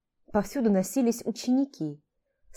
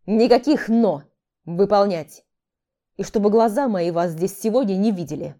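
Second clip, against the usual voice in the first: loud, angry hissing order; hissed command; 6 dB louder than usual. Acted. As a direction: bark the order with a hiss. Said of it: "прошипел демон".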